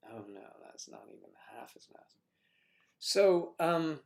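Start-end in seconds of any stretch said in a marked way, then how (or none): 0.1-2.2 quick conspiratorial whisper. Pretend. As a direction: hush it like a naughty secret. Joking aside.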